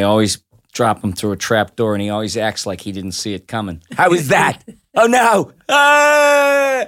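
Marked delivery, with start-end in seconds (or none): 3.9-6.9 hokey jester voice. Retold or imitated. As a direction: fall through the trapdoor squawking. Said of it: "I do the Jester".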